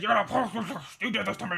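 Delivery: guttural barking into a snarled accusation; hammed-up frothing, rabid rant; hysterical barking. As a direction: imitate a man barking with rabies. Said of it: "And then he barked at me".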